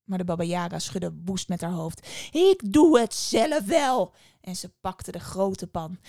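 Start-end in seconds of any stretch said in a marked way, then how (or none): 2.3-4.1 loud, slow, high witch snarl; angry witch. A narrator's reading. Witch loudly decides to do it herself. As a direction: bellow it slowly, fed up. Witch angrily takes over.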